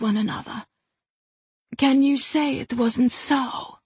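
high, distant voice of a spirit. Acted. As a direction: speak like a spirit bound in a gem. Rumayesh speaks to Brahma.

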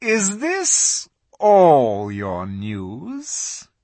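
very slow, cold witch voice; cruel witch. A narrator's reading. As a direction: savour each word cruelly. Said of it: "a slow, cruel smile".

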